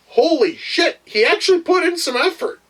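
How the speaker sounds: high-pitched incredulous outburst; amazed. Real, not acted. Reviewer marvels that a bad actor tried, amazed. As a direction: exclaim it in high-pitched astonishment.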